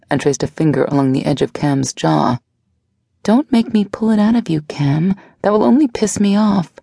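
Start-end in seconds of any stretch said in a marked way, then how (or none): 3.2-6.7 lightly voiced younger woman, coaxing; a gentle, probing lover; Blair's voice. A narrator's reading. Blair presses Cam.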